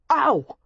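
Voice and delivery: high yelp of pain; sharp pained yelp. A narrator's reading. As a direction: yelp as if jabbed. Goose jabs his eye.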